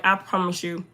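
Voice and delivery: ordinary delivery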